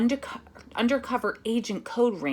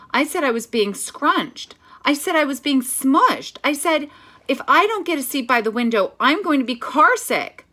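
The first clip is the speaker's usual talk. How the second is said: whiny complaint; 7 semitones higher, 6 dB louder than usual. Acted. Child complaining in carpool.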